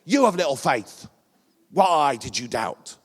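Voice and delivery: loud, harsh scolding; stern rebuke. Retold or imitated. Jesus scolds sinking Peter, loud and stern. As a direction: bark the rebuke down at him. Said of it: "would have said it like this".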